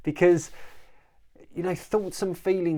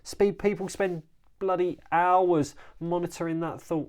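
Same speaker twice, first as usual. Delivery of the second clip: ordinary talk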